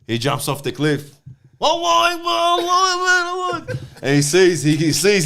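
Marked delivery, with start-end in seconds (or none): 1.6-3.8 mock falling cry. Man in a joke leaping off a cliff, high mock wail. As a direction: wail the prayer while plummeting, hammed-up.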